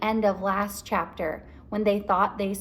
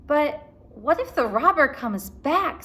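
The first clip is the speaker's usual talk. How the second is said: higher, louder worry; worried Annie; 6 semitones higher, 4 dB louder than usual. A narrator's reading. Annie fearing the robber returns, worried. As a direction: rise anxiously. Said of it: "said Annie".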